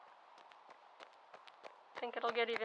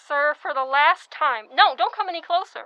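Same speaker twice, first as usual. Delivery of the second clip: stern warning; 3 semitones higher, 11 dB louder than usual. Acted. Nurse ordering man back.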